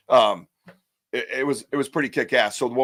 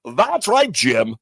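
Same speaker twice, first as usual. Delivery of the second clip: radio voice; 2 semitones higher, 6 dB louder, much slower than usual. Pretend. Host plays at broadcasting to his viewers, radio voice. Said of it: "I'm gonna do some radio voice".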